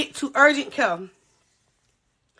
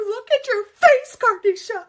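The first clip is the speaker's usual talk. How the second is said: devastated angry mother; 8 semitones higher than usual. Retold or imitated. Mother seeing her daughter's cut face, mock distraught cry. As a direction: imitate an upset mother in a high voice. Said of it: "my mother was devastated, hurt, abrupt, angry".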